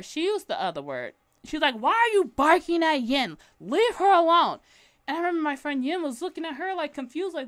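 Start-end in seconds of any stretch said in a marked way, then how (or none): 1.8-4.6 loud accusing question; confronting. Retold or imitated. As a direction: challenge her sharply, loud. Girl confronts her.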